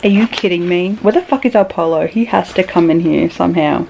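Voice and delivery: usual speaking voice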